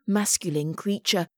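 plain reading voice

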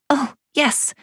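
put-on high, delighted child's yes; eager little girl. A narrator's reading. Amelia agreeing to a swim with her father, eager. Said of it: "Amelia nodded eagerly".

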